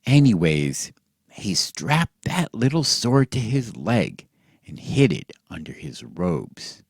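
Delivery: ordinary delivery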